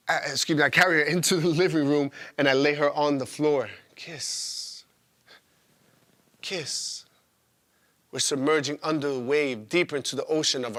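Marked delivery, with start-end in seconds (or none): 3.9-7.0 soft, breathed-out word; hushed kiss. Acted. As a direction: let it out almost as a whisper. Kisses punctuating a love scene.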